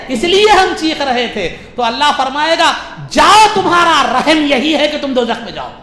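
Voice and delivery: high, loud dramatic retelling; full-force preaching. Acted. Preacher voices sinners pleading and Allah's verdict, full-force preaching. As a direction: declaim it to a packed hall.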